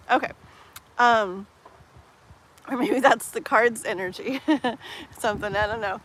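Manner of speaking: usual speaking voice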